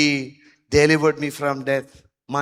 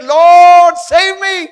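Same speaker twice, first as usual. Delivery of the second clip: high loud plea; acting the psalmist crying to the Lord, loud and high; 14 semitones higher, 15 dB louder, much slower than usual. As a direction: shout it up to heaven, desperate. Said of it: "cried to Jesus".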